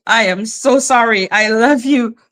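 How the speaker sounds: ordinary delivery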